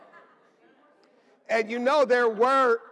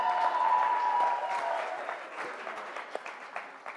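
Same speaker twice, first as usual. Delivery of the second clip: high breathy laugh; 4 dB quieter than usual. Real, not acted. After announcing Praveen's return.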